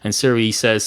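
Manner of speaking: usual speaking voice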